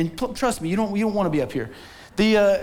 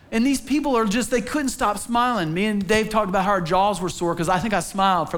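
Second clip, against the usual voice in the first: usual speaking voice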